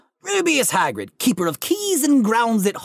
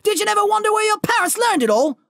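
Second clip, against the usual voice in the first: angry giant; 10 semitones higher than usual. Acted. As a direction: rage at the Dursleys over the boy.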